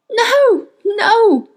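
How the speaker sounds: high, loud wail of "no"; anguished cry. A narrator's reading. Witch panicking as the robin demon is seized, anguished cry. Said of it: "cried the witch".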